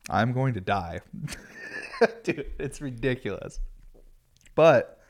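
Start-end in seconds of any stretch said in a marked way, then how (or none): 1.1-2.2 breathy high laugh at himself; short laugh. Real, not acted. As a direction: laugh it off at your own madness. Laughing at his own routine.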